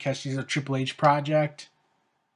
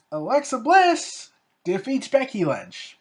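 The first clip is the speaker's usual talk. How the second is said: louder, higher ring-announcer call of the result; a wrestling announcer reading out the result; 7 semitones higher, 4 dB louder than usual. Acted.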